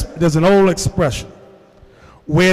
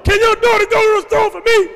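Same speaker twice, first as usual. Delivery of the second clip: very high hollered request; imitated yelling; 4 dB louder than usual. Father-in-law asking for the daughter, imitated yelling. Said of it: "he yells out".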